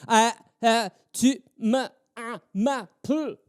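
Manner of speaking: mock too-busy clipped speech; chopped words. Shown as a demonstration. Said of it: "doing that with everything I say".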